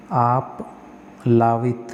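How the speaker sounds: आप्लावित drawn out very slowly, long laa; slow careful spelling. Shown as a demonstration. Teacher slowly repeats the correct word for students. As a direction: stretch आप्लावित syllable by syllable. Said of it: "आप्लावित होता है".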